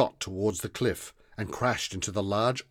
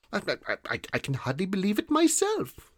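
high, halting, stuttering voice; shy stammering boy; 11 semitones higher than usual. A narrator's reading. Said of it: "with a shy smile said".

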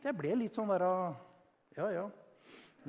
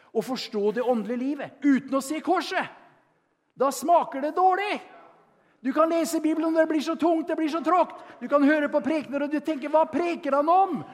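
raised, louder preaching build; fervent preaching; 8 semitones higher, 7 dB louder than usual. Acted. Preacher telling the Emmaus story to the church, fervent. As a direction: drive the story home to the room.

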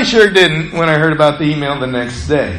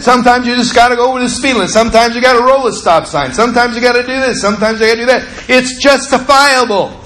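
high, insistent acted rant; mad self-justifying; 7 semitones higher, 3 dB louder, faster than usual. Driver defending his rule-breaking to his critic, mad and insistent. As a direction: rattle off excuses hotly, then stretch the last word. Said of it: "And I was mad".

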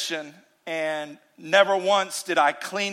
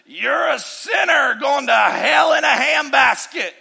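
shouted, higher mock condemnation; mock hellfire preacher; 7 semitones higher, 5 dB louder, slower than usual. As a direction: yell it like an angry pulpit-pounder. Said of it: "he's gonna say".

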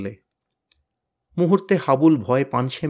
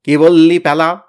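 louder menacing roar; 3 semitones higher, 10 dB louder than usual. A narrator's reading. Tenida bellows at Pyala for questioning him, loud roar. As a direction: bellow it at the kid. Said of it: "টেনিদার হুঙ্কারে".

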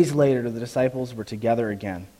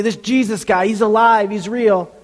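eager insistence; 8 semitones higher, 6 dB louder than usual. Retold or imitated. Friends insisting Jesus is real.

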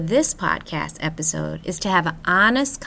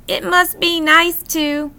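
envious inner voice; 9 semitones higher, 7 dB louder than usual. Retold or imitated. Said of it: "I've also had the thought".

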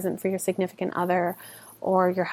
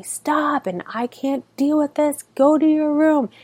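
higher-pitched, exasperated mock yell; mock parental yell; 7 semitones higher than usual. Parent flipping out at the kids, mock yelling. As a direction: act out a parent flipping out, only half shouting. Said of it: "to yell at them and say".

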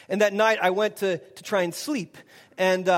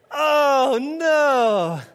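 a higher, louder cry of dismay; anguished oh no; 5 semitones higher, 5 dB louder than usual. Retold or imitated. Neighbor groaning to Tom about the dirt, anguished. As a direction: wail it like your day just got ruined.

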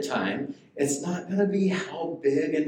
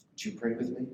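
usual speaking voice

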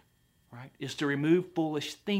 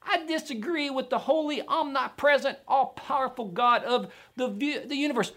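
high-pitched mock objector; defiant finite man; 8 semitones higher than usual. Finite man disagreeing with an all-powerful God, mock defiance. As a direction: puff up a silly, high-voiced dissent. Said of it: "a finite man with a finite brain".

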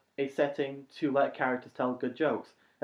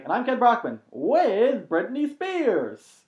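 slow, loud, stretched anchorman voice; Kent Brockman; 10 semitones higher, 6 dB louder, much slower than usual. Retold or imitated. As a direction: draw it out like a pompous newsreader. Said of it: "the only joke is Ken Brockman saying".

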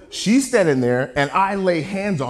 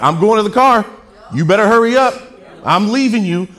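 loud, raised parental warning; acted parent hollering to hurry up; exasperated parent; 5 semitones higher, 9 dB louder than usual. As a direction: call out to dawdling kids as a threat. Voices parent hurrying dawdling kids.

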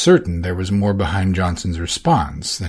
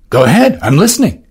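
higher, louder eager boss voice; voiced Orsino; 8 semitones higher, 8 dB louder than usual. Orsino urging Valentine to speak, eagerly. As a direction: lean in, keen to hear her.

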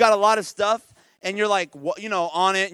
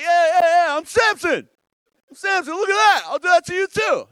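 bragging; 8 semitones higher, 8 dB louder than usual. Retold or imitated. Samson bragging.

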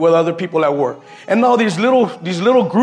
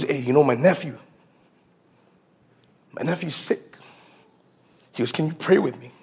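soft, lowered voice asking a favor; hushed plea; 4 semitones lower, 6 dB quieter than usual. Retold or imitated. Coworker quietly asks Mike to pray for his sick nephew, softly.

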